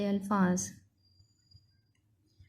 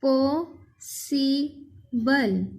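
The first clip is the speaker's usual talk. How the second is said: Possible as "पॉ", "सि", "बल" with long pauses; split into three; much slower than usual. Shown as a demonstration. Teacher dividing a word, possible in three far-apart beats. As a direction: say possible very slowly in three parts. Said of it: "इसके हमने तीन हिस्से".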